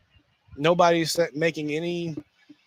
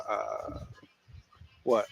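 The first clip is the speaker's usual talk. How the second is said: normal talking voice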